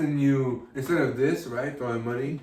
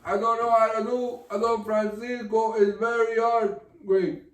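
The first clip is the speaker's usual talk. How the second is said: slightly higher, accented TV host impression; heavily accented English, pitched up a bit; Don Francisco; 3 semitones higher than usual. Shown as a demonstration. Comic struggling with a hard impression, pitched-up accented voice. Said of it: "I gotta work with my Don Francisco".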